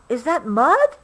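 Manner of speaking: eager little rhino asking; excited question. A narrator's reading. Obie spots a mound.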